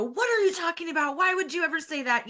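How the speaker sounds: sharp, high, indignant outburst; fly off the handle at the comment; reactive snap. Acted. To a partner about the airplane remark, a sharp indignant outburst. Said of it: "And I could just go".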